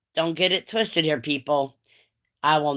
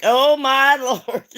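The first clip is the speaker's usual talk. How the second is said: high, loud, drawn-out shock with a laugh; mock scandalized; 9 semitones higher, 6 dB louder, slower than usual. Viewer reacting to a risqué movie, mock scandalized. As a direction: imitate scandalized amusement, stretched out.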